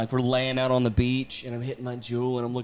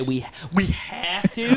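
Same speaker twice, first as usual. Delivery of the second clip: slow, drawn-out disbelieving question; incredulous echo; 3 semitones higher, slower than usual. Retold or imitated. Husband amazed that sex is required, incredulous. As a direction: stretch it out as if amazed. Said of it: "I've never had anybody say".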